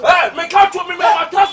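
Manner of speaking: loud sung-out threat; shouted half-sung taunt; shouting. Acted. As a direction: belt it out like a taunt. Loud comic threat in quarrel.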